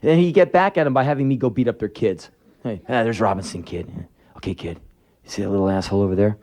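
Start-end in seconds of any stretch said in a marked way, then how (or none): 2.6-6.4 lightly voiced father, pitched lower; low, confiding aside to the kid; scheming dad. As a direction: imitate Dad pointing out a target. Dad singles out a rival's kid.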